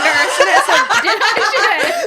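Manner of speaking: loud, high laughing retelling; cracking up mid-story. Real, not acted. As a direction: break into real laughter, stretching the words out. Cracking up retelling her question.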